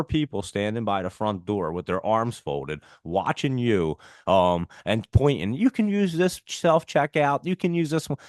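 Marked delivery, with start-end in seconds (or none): 5.5-8.2 high-pitched mock employee. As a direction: imitate the bored staff directing customers. Employees pointing customers to checkout.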